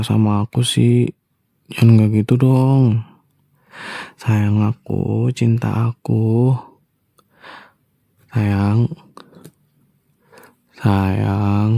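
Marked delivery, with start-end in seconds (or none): 4.2-11.7 slow, tender pet names; tender coaxing. Acted. Husband sweet-talking his silent wife, slow and tender.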